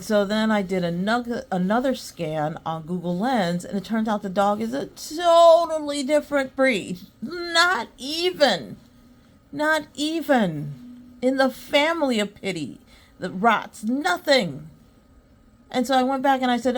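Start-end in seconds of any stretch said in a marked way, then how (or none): 4.9-14.7 higher-pitched, drawn-out emphasis on each phrase; emphatic disbelief. Real, not acted. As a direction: stretch out NOT EVEN and hit NOTHING. Emphatic reveal of wrong breed.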